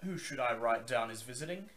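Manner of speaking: gruff male voice. Acted. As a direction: bark it like a gruff guard. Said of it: "says the man".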